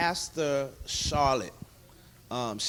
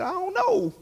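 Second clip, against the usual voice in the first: very high, loud mock wail; imitated crying; 7 dB louder than usual. Telling the pastor what's wrong, imitated tearful wail. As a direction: act out a helpless high-pitched bawl. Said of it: "I just started crying".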